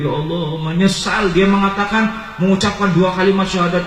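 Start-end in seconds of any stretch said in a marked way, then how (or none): none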